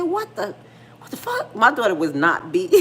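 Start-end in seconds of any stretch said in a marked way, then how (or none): 0.0-1.5 high, shocked what the fuck; shocked outburst. Retold or imitated. Mom reacting to daughter's news, shocked. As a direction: squeal it in disbelief. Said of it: "I said".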